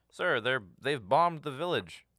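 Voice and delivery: loud, slow urgent soldier report; clone trooper report. Retold or imitated. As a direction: burst in with bad news, loud. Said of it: "That's not what clone troopers sound like".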